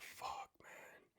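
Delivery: a soft breathless whisper, barely there; whispered curse. Retold or imitated. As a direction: let the curse slip out under your breath. Hearing her troubles, inner reaction.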